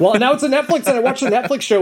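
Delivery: high, loud, bubbling enthusiasm; excited gush. Real, not acted. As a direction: burst out with fan excitement. Gushing about the Netflix show.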